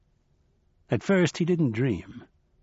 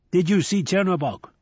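put-on bright, higher old trickster voice; Nancy, raised and playful; lively old man; 7 semitones higher than usual.

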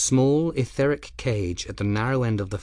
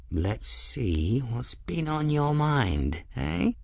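soft curious voice; 4 semitones higher, 5 dB quieter, slower than usual. Acted. Ferris peers at crystal.

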